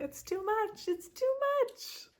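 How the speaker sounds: high, squealing disbelief; overwhelmed squeal. Real, not acted. Reader squealing to viewers at too many signs, overwhelmed.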